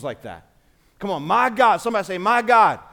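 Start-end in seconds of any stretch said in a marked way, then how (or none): 1.2-2.8 call-out. Real, not acted. Call and response with congregation.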